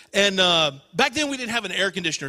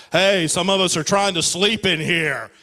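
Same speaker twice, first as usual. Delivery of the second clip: mock-irritated sleeper calling out; piling-on brother; 4 semitones lower than usual. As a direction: play a brother feigning annoyance at the noise. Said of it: "I have to pile on".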